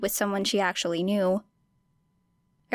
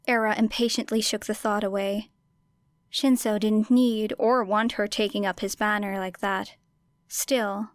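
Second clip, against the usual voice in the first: usual speaking voice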